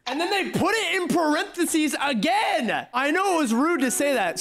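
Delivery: high-pitched incredulous rant; mock the message in a high, exasperated voice; exasperated mockery. Streamer complains a chatter repeated it in parentheses, high exasperated mockery.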